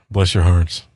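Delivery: sarcastic southern pity, dropped low; patronizing sigh of pity; mock pity. Real, not acted.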